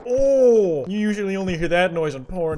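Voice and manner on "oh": high, loud mock moan "oh"; hammed-up pleasure moan. Streamer mocking a game noise with a moan.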